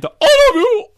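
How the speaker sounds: very high, loud squealing toy voice; Danny voice. Retold or imitated. Teddy bear given Danny's voice, loud squealed line. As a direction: shriek it like a deranged toy. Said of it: "the voice of Danny".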